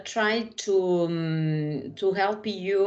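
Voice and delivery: normal talking voice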